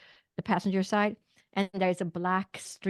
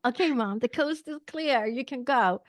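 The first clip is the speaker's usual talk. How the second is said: high, louder mock kid voice; imitating her kids; 5 semitones higher, 3 dB louder than usual. Kids at the car windows tell mom to drive on, imitated kid voices. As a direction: call it out higher, like kids in the car. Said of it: "my kids' noses literally are pressed up against the windows saying".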